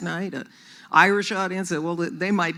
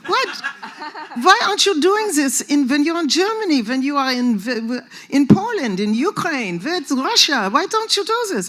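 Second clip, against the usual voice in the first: loud, high German manager demanding; indignant German-accented imitation; 8 semitones higher, 7 dB louder than usual. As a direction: badger in a hammed-up German accent. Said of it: "I wanna hear your German accent".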